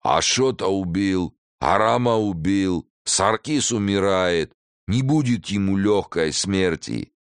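put-on slow, heavy Caucasian-accented threat. A narrator's reading. One-armed leader answers his men in a Caucasian accent. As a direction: weigh every word, accent thick. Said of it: "ответили басом, но с сильным акцентом".

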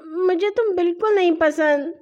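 put-on high, tearful child's voice; whiny mock sobbing tone; acted crying. A narrator's reading. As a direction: wail it like a hurt little boy. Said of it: "ہاشم نے روتے ہوئے کہا".

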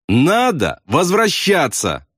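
slow, high, word-by-word demand; understated fury, each word stretched high; infuriated shout. A narrator's reading. Bombardier demands pilot turn back, infuriated shout. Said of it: "остервенился Ясариан".

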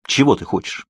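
usual speaking voice

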